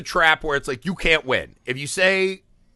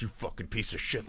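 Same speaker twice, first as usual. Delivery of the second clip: seething curse; 6 semitones lower, 14 dB quieter than usual. Retold or imitated. Inner voice cursing at husband.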